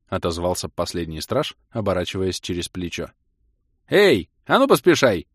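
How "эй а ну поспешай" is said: much louder and higher call; shout to straggler. A narrator's reading. Guard shouting at the last one to hurry, loud. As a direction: yell back down the street.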